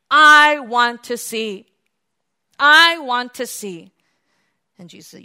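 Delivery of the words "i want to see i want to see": loud, slow, impassioned plea; acted blind man begging loudly; pleading cry. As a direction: cry it out, leaning hard on the first word. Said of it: "in his humble state and pitiful state".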